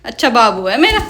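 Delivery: sweet coaxing. Real, not acted. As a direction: coax the sulking parrot back tenderly. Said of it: "अच्छा डांटूंगी नहीं".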